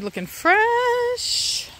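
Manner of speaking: high, long-drawn admiring word; playful singsong. Real, not acted. Gardener admiring the garden, playful singsong. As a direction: stretch it out high and pleased.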